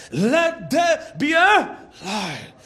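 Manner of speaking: slow, weighty "Let there be a light"; emphatic proclamation. Shown as a demonstration. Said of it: "God said".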